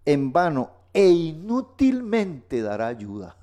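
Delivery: high, slow, emphatic verse reading; ringing, drawn-out declaration from the pulpit; proclaimed scripture. Real, not acted.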